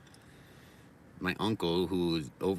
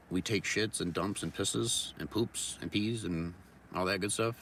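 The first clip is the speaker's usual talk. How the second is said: ordinary delivery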